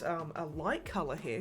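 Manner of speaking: normal talking voice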